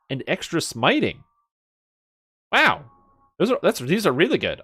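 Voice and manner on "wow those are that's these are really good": much higher and louder, genuinely thrilled; light up at a great find; impressed wow. Real, not acted. Streamer reading great bracer stats, loud impressed excitement.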